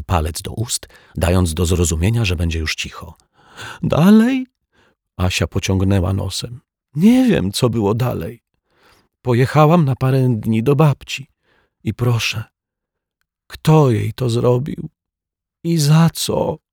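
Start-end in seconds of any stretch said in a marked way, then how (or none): 3.8-4.5 put-on very high, bright child question; puzzled little girl. A narrator's reading. Asia asks the detective what happened next, high and puzzled. 6.9-16.6 put-on higher, childlike and plaintive; a child demanding who hurt her pet; upset little girl. A narrator's reading. Asia asks the detective who did it, high plaintive child voice.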